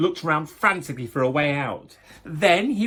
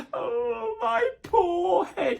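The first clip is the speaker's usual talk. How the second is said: high, slow crying moan; moaning wolf; much slower than usual. Acted. Wolf waking with a sore head, high crying moan. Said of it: "he moaned".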